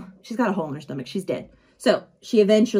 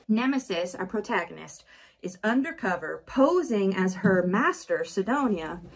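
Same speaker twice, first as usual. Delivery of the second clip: plain reading voice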